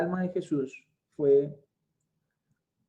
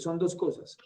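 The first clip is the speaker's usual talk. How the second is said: ordinary delivery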